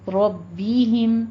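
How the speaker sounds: ربہم slow with heavy ر and thin ب; correct tajweed. Shown as a demonstration. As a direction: draw out ربہم, thick ر, light ب. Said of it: "را کو مفخم رکھے". (درست: ربہم، را مفخم)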